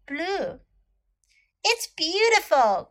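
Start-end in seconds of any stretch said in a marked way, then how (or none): 1.6-2.9 high-pitched exclamation of delight; delighted cry. A narrator's reading. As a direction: cry out in admiration. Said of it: "cried Maud".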